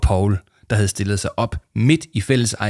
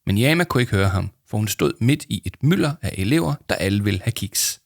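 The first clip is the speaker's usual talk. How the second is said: usual speaking voice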